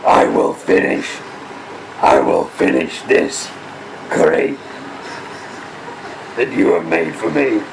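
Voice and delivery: deep, gravelly Sith threat; deep Darth Maul voice. Pretend. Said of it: "You sound more like Batman".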